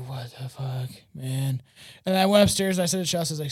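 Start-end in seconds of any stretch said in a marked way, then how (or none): 0.1-1.6 low, soft, mildly stunned aside; hushed retold disbelief. Man retelling his reaction to the TV shutting off, hushed disbelief. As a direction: drop it low and quiet, as if baffled.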